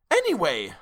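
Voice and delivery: crying out. A narrator's reading. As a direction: blurt it out eagerly. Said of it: "I cried".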